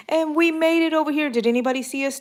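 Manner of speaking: put-on gleeful like a kid who got away with it; playful triumph. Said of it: "sneaking over".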